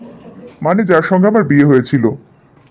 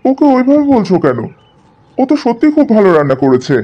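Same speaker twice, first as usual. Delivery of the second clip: raised, higher voice, scolding the mother-in-law; indignant reproach; 6 semitones higher, 4 dB louder than usual. Acted. Father-in-law scolding his wife for insulting the daughter-in-law, raised indignant voice. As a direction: push back hard in her defense, louder.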